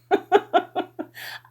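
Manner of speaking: put-on high drunken giggle. A narrator's reading. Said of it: "I giggled".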